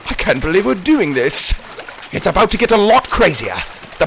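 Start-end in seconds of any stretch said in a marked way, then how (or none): none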